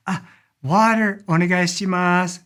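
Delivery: onegaishimasu with the final mas dragged out. Shown as a demonstration. Teacher asks a waiter for water, drawing out mas. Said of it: "See how I'm dragging that mas out there".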